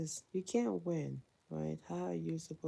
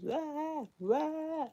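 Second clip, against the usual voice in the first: high mock scream of a toddler; a child shrieking in the car seat, played up; 12 semitones higher, 4 dB louder than usual. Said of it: "he screams and just".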